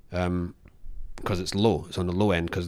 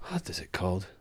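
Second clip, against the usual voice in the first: near-whispered, softer and lower; muttering to himself; 5 semitones lower, 9 dB quieter than usual. Real, not acted. Searching for the job's name, muttering to himself. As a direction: whisper it as a question to yourself.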